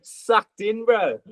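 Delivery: mocking mate. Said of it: "And he just goes".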